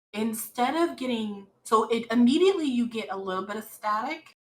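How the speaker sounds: ordinary talk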